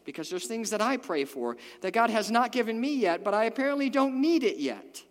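loud, high emphatic preaching; full-force preaching. Acted. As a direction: preach it with rising fire.

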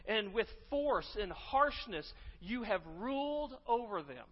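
preacher reading Ezekiel's rebuke of Israel's shepherds with building force; God chastising shepherds. Acted. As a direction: preach the indictment, hammering each not. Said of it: "He says this".

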